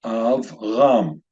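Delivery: Avram split slowly into Av and ram; syllable-by-syllable demonstration. Shown as a demonstration. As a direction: sound out Avram as two separate syllables. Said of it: "divided into two syllables. It is pronounced".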